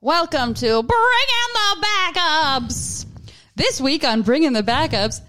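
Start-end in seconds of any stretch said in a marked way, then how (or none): 0.0-3.0 announcer voice. Pretend. Podcast intro announcer voice.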